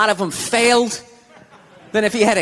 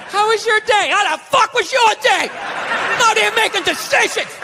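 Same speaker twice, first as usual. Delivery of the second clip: higher, hammed-up venting at home; mock exasperated husband; 6 semitones higher than usual. Husband home from work venting at his wife, mock rant. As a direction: dump the bad day on her.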